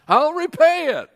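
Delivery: high, shouted promise to repay; desperate cry. A narrator's reading. Servant crying out to the king that he will repay, shouted.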